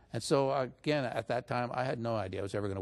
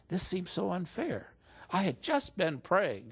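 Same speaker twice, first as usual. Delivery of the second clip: retold anger; 7 semitones higher, slower than usual. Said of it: "but I really got angry".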